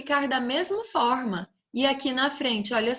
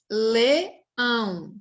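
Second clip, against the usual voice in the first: LEÃO parted into "Le... ão"; syllable by syllable; 3 dB louder than usual. Shown as a demonstration. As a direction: say Leão as two separate syllables. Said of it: "Duas sílabas".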